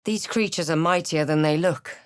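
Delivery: ordinary talk, nothing marked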